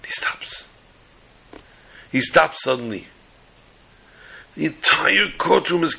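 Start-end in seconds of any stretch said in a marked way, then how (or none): 0.0-0.7 hushed suspense whisper; whispering the pause before the reveal. A narrator's reading. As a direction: drop to a breath for the dramatic pause.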